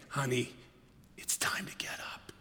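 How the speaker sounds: hushed loving call; tender whisper. Acted. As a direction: breathe it gently to someone asleep. Jesus waking believer, tender.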